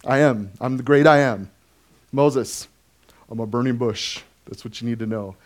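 a hint of a calm, matter-of-fact deity; God. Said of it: "And he says".

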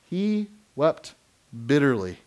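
pause, then bitterly; stress on He wept. Shown as a demonstration. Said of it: "repeated for emphasis".

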